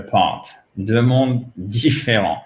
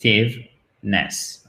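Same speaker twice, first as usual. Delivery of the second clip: "Effectiveness" slowly split into four separate syllables; slower than usual. Shown as a demonstration. Teacher counting four syllables for learners, "Effectiveness" broken apart. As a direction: break "Effectiveness" into e, ffec, tive, ness. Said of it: "quatre syllabes".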